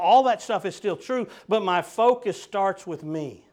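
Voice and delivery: loud, driving preacher's build; full-voiced pulpit fervor; preaching. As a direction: preach it out to the congregation.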